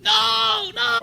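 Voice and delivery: very high, loud mock scream; imitated scream. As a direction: shriek it like a struggling old woman. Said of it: "she starts screaming".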